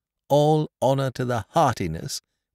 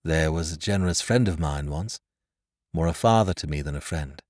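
put-on earnest young man, in a lower voice; lightly voiced Edward; 8 semitones lower than usual. A narrator's reading. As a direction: answer the accusation in a low, measured tone.

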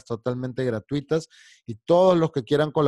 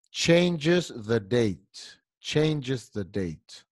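very slow, drawn-out model phrase; slow modelling; much slower than usual. Shown as a demonstration. Teacher slowing down for learners. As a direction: spell it out slowly for the class.

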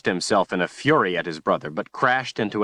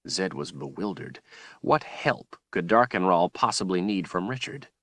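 ordinary delivery

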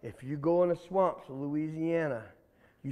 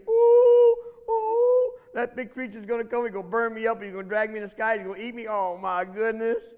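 terrified alligator; 3 semitones higher, 5 dB louder than usual. Acted. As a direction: squeal and tremble as if the monster is coming. Alligator terrified of imagined creature.

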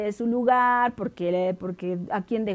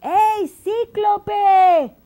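high, drawn-out call; 9 semitones higher, 7 dB louder, much slower than usual. Acted. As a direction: hail the giant from afar. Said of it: "Ulises le dijo". (llamado alto y alargado)

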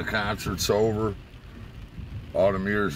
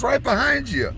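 much higher, loud reveal; Bobby's reveal; 4 dB louder than usual. Retold or imitated. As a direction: point it out gleefully. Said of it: "He goes".

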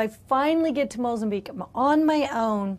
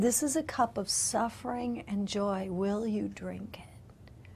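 hushed question; 7 dB quieter than usual. Retold or imitated. God offering her the cup, in a hushed voice. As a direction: ask it tenderly under the breath.

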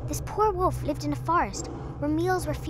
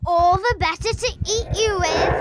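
loud, higher pounce; menacing wolf roar; 8 semitones higher, 9 dB louder than usual. A narrator's reading. Wolf revealing himself to girl, loud menacing roar. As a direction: burst out and lunge.

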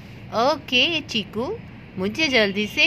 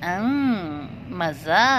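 villainous cat purr; 7 semitones lower, 3 dB quieter, much slower than usual. Acted. Cat villain gloats over trapped duck.

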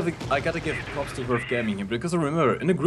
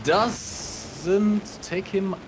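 slow suspense; 5 semitones higher, much slower than usual. Real, not acted. Caster watching a risky dive.

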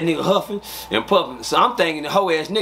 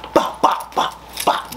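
breathy unvoiced bop bop sounds; mock punch sounds; imitated punches; much slower than usual. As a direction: whisper the blows like a beatdown. Retelling beating him up.

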